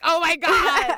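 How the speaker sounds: loud, high mock gasp of recognition; mock shock. Friend spotting bald man in pleated pants, mock shock. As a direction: act like you just saw it. Imagined reaction to bald dude.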